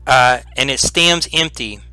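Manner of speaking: slow, measured read-aloud description; game master's scene setting. A narrator's reading. Game master describing the ruined chamber to players, slow and measured. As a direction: paint the room for the players slowly.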